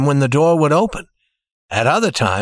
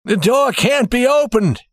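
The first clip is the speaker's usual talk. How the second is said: put-on old banker's voice, higher and alarmed; 10 semitones higher than usual. A narrator's reading. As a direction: cry out that the door is stuck. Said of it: "he cried".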